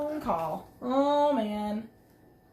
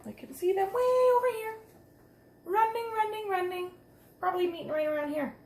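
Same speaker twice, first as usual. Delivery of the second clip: higher, eager; excited pointing; 6 semitones higher than usual. Real, not acted. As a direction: spot them with delight. Excitedly pointing out tiny figures.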